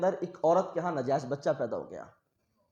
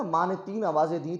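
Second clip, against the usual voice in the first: normal talking voice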